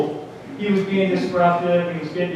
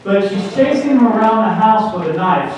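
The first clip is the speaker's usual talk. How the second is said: ordinary talk, nothing marked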